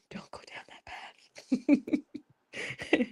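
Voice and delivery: hushed warning whisper; whispered aside to the audience. Acted. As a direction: breathe it like a secret warning. Warning a friend off mistakes.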